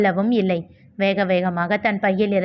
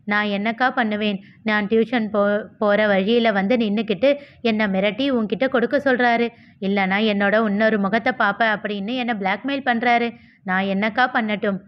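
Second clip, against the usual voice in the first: plain reading voice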